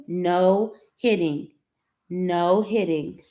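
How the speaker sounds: very slow, each word spaced; slow firm no. Real, not acted. Mother slowly repeating the rule to her son, very slow and firm.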